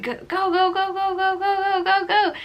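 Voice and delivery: high, chanted go go go like cheering nurses; mock delivery room cheer, high and quick; nurses urging. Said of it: "they're like".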